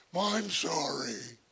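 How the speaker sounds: put-on slow, grudging kid's apology; insincere sorry. Sulky child forced to apologize to his sibling, slow and grudging. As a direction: drag it out like you don't mean it. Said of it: "I can tell by the tone of his voice he's not really sorry".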